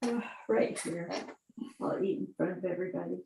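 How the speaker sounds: quiet, pained voice; hurting. Real, not acted. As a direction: speak softly through pain. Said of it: "You sound like you're hurting".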